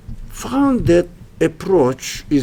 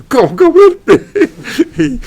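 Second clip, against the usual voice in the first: laughing come on; 14 semitones higher, 6 dB louder, much slower than usual. Real, not acted. Teasing audience about technology.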